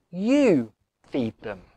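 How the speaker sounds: "You" slowed and pressed hard, "feed them" slow and weighty; stress on YOU. Shown as a demonstration. A preacher stressing to his flock that "You" must feed them. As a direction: lean hard on "You" and let "feed them" land slowly. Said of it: "The "you" in the Greek is actually emphatic".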